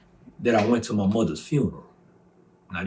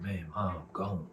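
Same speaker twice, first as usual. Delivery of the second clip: repeating bad news; 3 semitones lower, 10 dB quieter, slower than usual. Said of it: "He said".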